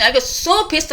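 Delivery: usual speaking voice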